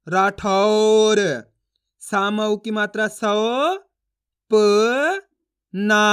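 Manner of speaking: slow chant, single syllables held long and loud; syllable reading drill. Shown as a demonstration. Teacher modelling word reading for learners, drawn out and emphatic. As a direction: stretch each sound apart, then blend it evenly. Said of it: "इन अक्षरों का उच्चारण कर रहा हूँ".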